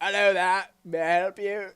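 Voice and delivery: loud, high Australian accent attempt; Steve Irwin. Shown as a demonstration. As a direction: holler it in throat-straining mock Australian. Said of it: "Steve Irwin's voice?".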